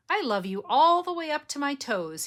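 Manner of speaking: high, loud gleeful squeal upside down; excited child. Acted. Little hare upside down, proud of reaching his toes. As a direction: call it out proudly, straining.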